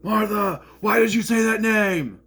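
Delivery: mock anguished shout, high and loud; Heavy Superman impression. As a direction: yell it like a tormented hero. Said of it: "I did that".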